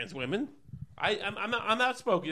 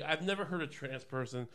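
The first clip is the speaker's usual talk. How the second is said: ordinary talk, nothing marked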